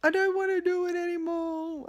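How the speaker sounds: high, breaking plea on the edge of tears; tearful plea. Retold or imitated. To the nurse, breaking down in tears and pleading. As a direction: voice cracking as the tears come. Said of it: "the waterworks started".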